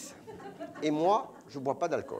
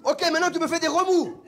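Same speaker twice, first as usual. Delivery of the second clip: much higher instruction; director's order; 10 semitones higher than usual. Retold or imitated. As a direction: command it brightly. Directeur donne consigne. (directeur aigu qui donne l'ordre)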